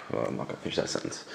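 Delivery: fast hushed aside; quiet aside. Real, not acted. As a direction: drop to a quick murmur. Cuts himself off, drops voice.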